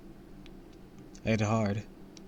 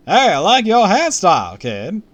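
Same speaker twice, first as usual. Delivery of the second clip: loud, high showman; hammy celebrity charm; TV host voice; 10 semitones higher, 14 dB louder than usual. Acted.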